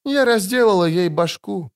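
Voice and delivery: high, dazed confession; high-pitched blurred speech; indistinct mumbling. A narrator's reading. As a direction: say it thickly, in a high voice. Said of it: "невнятно проговорила ведьмачка".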